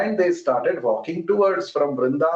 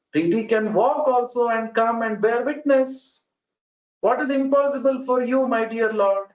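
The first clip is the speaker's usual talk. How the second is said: much higher, insistent questioning of the Lord; fervent counter-argument; 7 semitones higher than usual. Retold or imitated. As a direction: argue back with rising fervour. Young man counter-argues the Lord.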